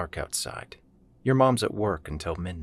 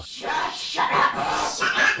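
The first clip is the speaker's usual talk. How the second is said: harsh, voiceless yell; loud rasped command from angry creatures; 6 dB louder, much slower than usual. A narrator's reading. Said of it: "And they yell".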